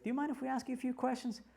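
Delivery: high-pitched request for an interview; news reporter. Retold or imitated. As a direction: ask sweetly as a mock interviewer. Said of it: "the news person comes to me".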